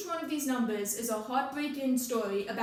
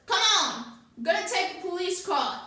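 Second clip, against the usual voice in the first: raised, higher-pitched yell; gunman's urgent order; 6 semitones higher, 5 dB louder than usual. Acted. Rhodes runs up gun in hand, urgent shouted order. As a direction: call out an urgent command.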